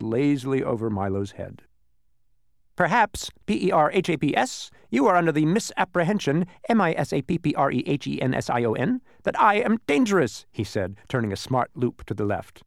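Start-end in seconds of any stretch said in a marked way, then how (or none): 2.8-10.5 high, loud bee spelling out. A narrator's reading. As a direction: reassure while spelling. Spelling bee reassures Milo.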